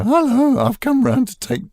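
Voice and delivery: bright hammed-up radio-play juvenile calling at the door; juvenile radio lead. Actor recalls radio plays, playing a young leading man, high and eager. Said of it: "they needed sort of juvenile leads to go".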